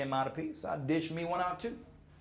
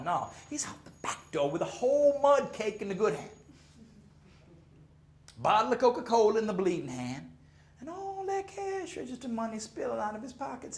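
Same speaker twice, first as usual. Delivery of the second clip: ordinary talk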